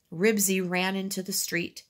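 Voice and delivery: ordinary delivery, nothing marked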